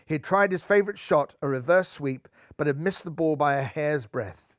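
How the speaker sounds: usual speaking voice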